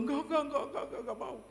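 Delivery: high, rapid mock refusal; imitating frightened child. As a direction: play a scared child refusing. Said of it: "Takut, Saudara". (menirukan anak ketakutan menolak)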